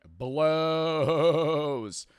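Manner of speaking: very slow, with "blows" stretched out long; drawn-out disdain. Real, not acted. Host dismissing medical school, long drawn-out "blows". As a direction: hold the vowel of "blows" for seconds.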